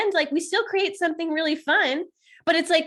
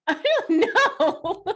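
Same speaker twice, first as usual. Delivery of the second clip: doubtful drawl; 3 semitones higher, much slower than usual. Retold or imitated. Imitating doubtful partner.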